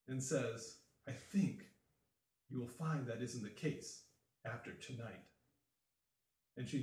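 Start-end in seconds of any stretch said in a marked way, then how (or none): 1.1-5.2 soft, sinking villain's reply; quiet menace. Acted. Llewellyn bending over the dying Baroness, quiet menace. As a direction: let it drop softly, coolly certain.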